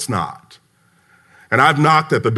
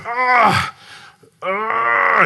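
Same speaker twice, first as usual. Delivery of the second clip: long, high strained grunts; repeated high-pitched straining noises; imitated child grunt; 11 semitones higher than usual. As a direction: grunt like a hurt boy holding back tears. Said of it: "He keeps grunting".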